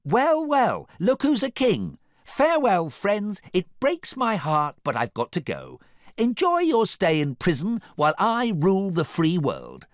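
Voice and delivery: high, loud, grandly triumphant; over-the-top, high-pitched farewell speech; gloating farewell. A narrator's reading. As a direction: crow it to a whole crowd. Artie hamming a farewell to crowd.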